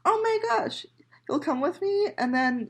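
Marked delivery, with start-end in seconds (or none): 0.1-0.9 high, thrilled squeal; excited squeal. Real, not acted. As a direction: gush with excitement. Excited at friend coming.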